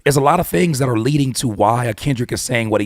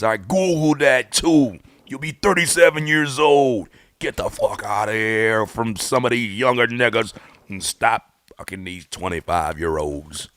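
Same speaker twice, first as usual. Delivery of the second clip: [name] impression; 2 semitones higher, 3 dB louder than usual. Podcaster scolding [name] about his age, slow impression. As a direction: do your best [name]. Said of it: "to my best [name]".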